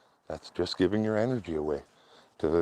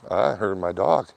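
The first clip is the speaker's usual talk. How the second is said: ordinary talk, nothing marked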